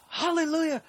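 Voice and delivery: high mock outburst; hammed-up crazed yell; imitating the rant. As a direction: belt it like the crazed dad. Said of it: "he's like".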